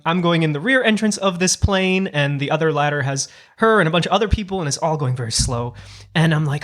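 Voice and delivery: usual speaking voice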